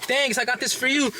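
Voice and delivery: high, sly put-on thanks; mock friendly villain. Villain before shooting the henchman, high sly mock thanks.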